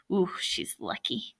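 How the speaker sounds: unvoiced whisper aside. Real, not acted. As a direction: breathe it under your breath. Reader's aside.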